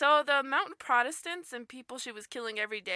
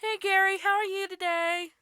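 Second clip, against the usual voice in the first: high quoted voice; 8 semitones higher, 5 dB louder than usual. Retold or imitated. Quoting a song voice.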